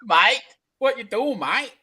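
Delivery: mock Australian "Mate, what you doing, mate"; Aussie mate. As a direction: ham up an Aussie greeting. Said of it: "it's how you emphasize it".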